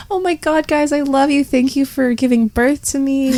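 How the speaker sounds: high, overdone gushing thanks; high mock-sappy gratitude to mom and dad; mock gushing child. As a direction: gush at parents in a high, sugary voice. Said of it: "I'm not like".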